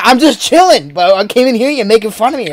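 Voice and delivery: ordinary delivery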